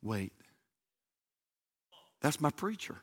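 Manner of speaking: low, soft urgent wait a minute; hushed Brandon. Retold or imitated. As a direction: Brandon quietly stops the officer, hushed. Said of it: "And Brandon says".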